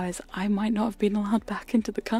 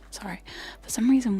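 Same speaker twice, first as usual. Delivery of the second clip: ordinary talk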